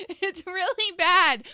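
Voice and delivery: laughing disbelief. Real, not acted. Laughing about Biden.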